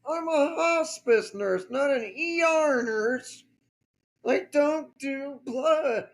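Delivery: high-pitched, indignant protest without volume; half-voiced yell, pitched high; mock yelling back. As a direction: imitate a nurse yelling in self-defence. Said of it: "she yells back".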